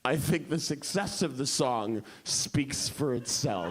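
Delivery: slow, lightly self-important songwriter; a hint of smugness, taking your time; arrogant. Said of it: "this arrogant woman".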